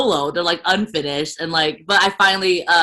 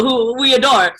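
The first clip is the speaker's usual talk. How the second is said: ordinary talk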